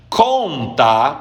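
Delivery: "CONta" with a long first syllable; stress on CON. Shown as a demonstration. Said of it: "Onde está a sílaba tônica?". (tônica alongada no CON de "conta")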